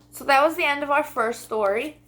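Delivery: usual speaking voice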